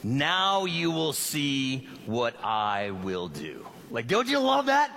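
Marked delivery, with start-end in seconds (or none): 0.0-3.7 slow, unison recitation; reading together aloud. Shown as a demonstration. Church reads the verse aloud together, slow and unison. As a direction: recite it slowly with everyone. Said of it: "let's say this verse out loud together".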